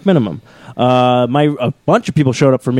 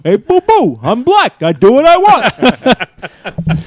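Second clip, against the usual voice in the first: higher cartoon bear voice, very slow last words with a laugh; Yogi Obama; 7 semitones higher, much slower than usual.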